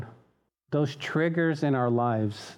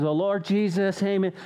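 rushed two-second prayer; 5 semitones higher than usual. Retold or imitated. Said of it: "people expect us to pray in two seconds".

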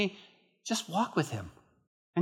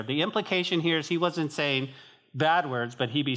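ordinary talk

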